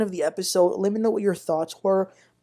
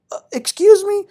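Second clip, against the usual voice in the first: sharp, much higher and louder disbelief; indignant excuse me; 13 semitones higher, 9 dB louder than usual. Real, not acted. Vlogger reacting to Damon, outraged and loud. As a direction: pitch it up hard, offended.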